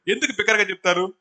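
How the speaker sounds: ordinary talk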